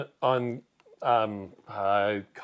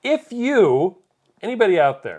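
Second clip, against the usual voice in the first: louder, higher call to the audience; 7 semitones higher, 6 dB louder than usual. Real, not acted. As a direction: hail everyone watching. Calling out to viewers.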